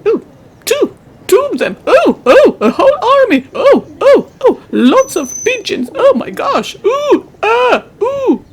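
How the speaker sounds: very high, swooping oohs; giddy, high-pitched wonder; thrilled amazement. Acted. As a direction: squeal with delight at every new sight. Delight at an army of pigeons.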